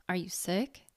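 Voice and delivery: soft, quiet suspicious question. A narrator's reading. Heather eyes the visitor and quietly asks, suspicious. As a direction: ask it warily, under your breath. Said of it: "eyeing me up suspiciously".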